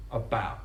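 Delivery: "about." ending on a glottal stop, no T. Shown as a demonstration. Host demonstrating the Essex glottal T in "about.". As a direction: cut off the T in "about.". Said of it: "that glottal T on about".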